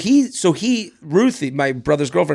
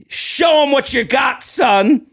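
cheering parent; 8 semitones higher, slower than usual. Retold or imitated. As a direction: call out like a proud dad egging his son on. Parents cheering him on at table.

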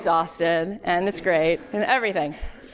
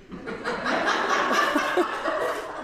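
breathless laugh; 11 semitones higher than usual. Real, not acted. Laughs at own mock whining.

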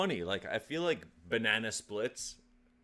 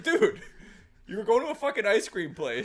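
loud, high, disbelieving dude; hammed-up indignant rant at a friend; exasperated outburst; 9 semitones higher, 6 dB louder than usual. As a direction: blow up at the buddy in mock outrage. Acted exasperated reply to buddy.

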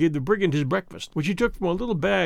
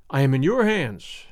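usual speaking voice

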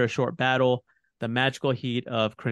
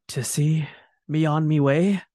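breathy dying whisper; 4 semitones higher, 4 dB louder than usual. A narrator's reading. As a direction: whisper it weakly, near death.

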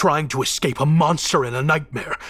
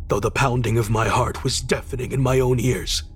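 usual speaking voice

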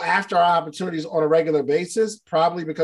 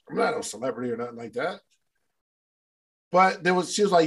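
ordinary talk, nothing marked

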